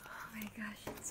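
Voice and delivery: soft unvoiced whisper; ASMR whisper. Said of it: "A S M R".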